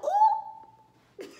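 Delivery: very high, loud swooning whoop; thrilled squeal. Retold or imitated. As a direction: squeal at a romantic line. Swooning reaction to the line.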